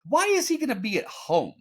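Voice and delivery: high-pitched rhetorical question; incredulous. Real, not acted. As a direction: ask it in high, baffled disbelief. Mocking Brock's illogical assumption.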